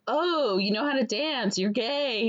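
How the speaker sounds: acting out a delighted discovery; mock realization. She teases him about knowing how to dance, mock surprise. Retold movie line, teasing realization.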